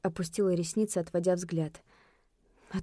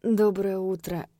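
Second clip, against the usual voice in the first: purring; slower than usual. Acted. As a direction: purr the greeting lazily. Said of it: "мурлыкнул он".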